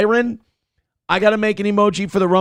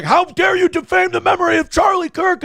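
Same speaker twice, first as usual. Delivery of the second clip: mock yell; 12 semitones higher than usual. Panelist yelling at the liberal guy, imitated indignant shout. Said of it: "they yelled at that guy".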